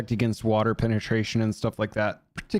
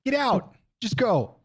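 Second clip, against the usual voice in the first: incredulous; 11 semitones higher than usual. Real, not acted. Disbelief at the price.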